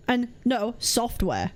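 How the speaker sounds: ordinary delivery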